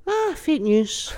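high, slow dismissive Trump; mock Trump brushing off the question; Trump impression. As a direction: wave it off like Trump. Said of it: "Donald Trump going".

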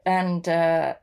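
ordinary delivery

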